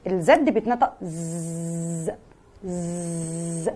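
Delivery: low soft ز buzz; buzzing fly. Shown as a demonstration. Teacher buzzing ز like a fly around her. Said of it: "زي الدبانة".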